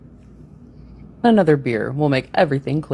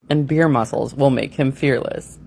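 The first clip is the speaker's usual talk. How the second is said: ordinary delivery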